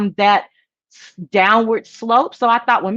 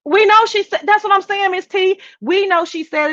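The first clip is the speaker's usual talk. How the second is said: excited agreement; 11 semitones higher than usual. Real, not acted.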